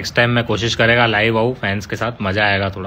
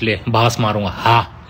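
usual speaking voice

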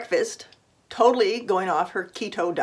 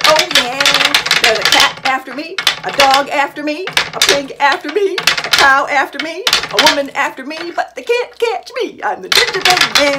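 boastful gingerbread man; 7 semitones higher, 8 dB louder than usual. Acted. Gingerbread man boasting while running.

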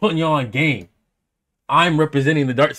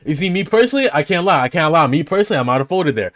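usual speaking voice

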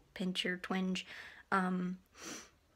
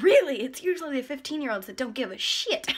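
surprised piercer; 5 semitones higher, 7 dB louder than usual. Retold or imitated. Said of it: "And he said".

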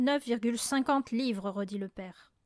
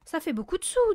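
put-on boy amazed; 6 semitones higher, 6 dB louder than usual. A narrator's reading. Son marvels at money.

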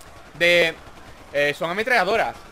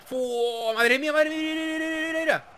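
excited; 7 semitones higher than usual. Real, not acted. Amazed at battle on screen.

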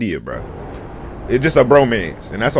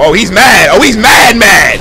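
loud, building higher each time; thrilled shout; 7 semitones higher, 10 dB louder, slower than usual. Real, not acted. Watcher seeing the fighter get angry, excited shouting.

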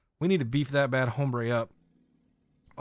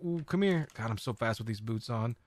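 ordinary talk

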